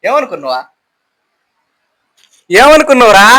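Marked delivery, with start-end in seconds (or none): 2.5-3.3 loud, higher-pitched questioning; loud, impatient repeat of a question; insistent demand. Real, not acted. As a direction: raise your voice to force an answer. Repeats unanswered question, raising voice.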